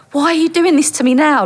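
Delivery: retold plaintive complaint; acted exasperation. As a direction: protest in disbelief, pitched well up. Exasperated thought as man approaches.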